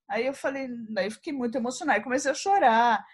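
ordinary talk, nothing marked

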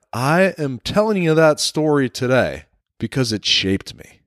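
normal talking voice

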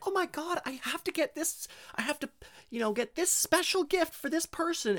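high frantic mock voice; mock stressed shopper. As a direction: squeal it in a frazzled panic.

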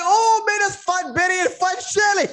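excited admiration. Retold or imitated. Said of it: "He's like".

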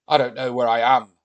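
loud voice of a lost Viking. Acted. Lost Viking replying to Mummy, loud. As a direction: blurt it out as a big Viking.

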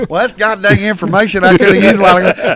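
loud, high exasperated comeback; annoyed retort. Retold or imitated. Coach tells the father he could have used that earlier, loud and irritated. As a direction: let him have it, irritated.

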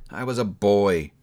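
usual speaking voice

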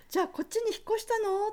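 high, quick question; teacher's voice. Retold or imitated. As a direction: ask it briskly in the teacher's voice.

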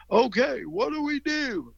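high, slow Tennessee drawl; Southern accent. Retold or imitated. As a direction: imitate a Southern karate teacher calling to kids. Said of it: "'Cause he had a Southern accent".

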